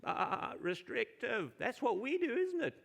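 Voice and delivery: sheep impersonation. Pretend. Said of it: "my best sheep impersonation".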